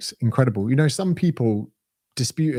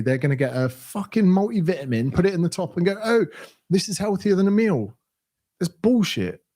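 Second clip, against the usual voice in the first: high-pitched, emphatic, genuinely annoyed tirade; exasperated rant; 5 semitones higher than usual. Real, not acted. Streamer telling viewers not to trust companies, real exasperation. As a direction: drive each point home, voice raised in pitch. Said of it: "you're genuinely pissing me off".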